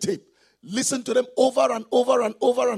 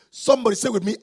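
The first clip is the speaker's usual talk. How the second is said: ordinary talk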